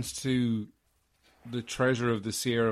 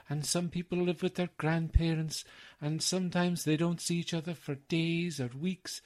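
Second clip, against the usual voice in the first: higher, gentle old nanny impression; raised-pitch character voice, measured and tender; quoting the last scene; 5 semitones higher than usual. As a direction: imitate a kindly screen nanny reassuring children. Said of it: "where he's talking about".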